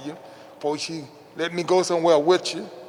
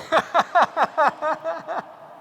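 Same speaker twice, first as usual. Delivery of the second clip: big laugh; 10 semitones higher than usual. Real, not acted. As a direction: laugh out at the memory. Laughs at the coach's order.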